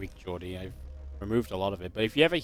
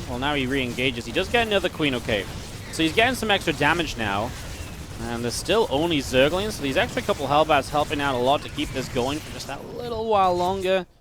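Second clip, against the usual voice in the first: excited esports caster, louder in bursts; live play-by-play; 4 semitones higher, 4 dB louder than usual. Real, not acted. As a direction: call the fight as it unfolds, pushing key words.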